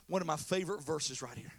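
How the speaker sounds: ordinary delivery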